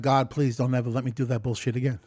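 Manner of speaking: low, soft and hurried, pleading with God; a murmured plea rushed out under the breath; hushed quick prayer. Acted. Praying to God never to relapse, hushed and hurried.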